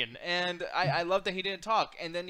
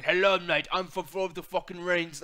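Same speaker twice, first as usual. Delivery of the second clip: lightly mocking British accent, slower; a half-hearted British accent sneering at a wrestler; 3 semitones lower than usual. Wrestling fan mocking a wrestler's British accent, light British accent. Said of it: "I hate that accent".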